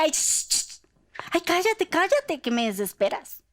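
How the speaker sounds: irritated, high hushing; annoyed shushing. Acted. Crowd hushing the blind beggar, annoyed. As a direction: shush him like he's a nuisance. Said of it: "la gente le decía".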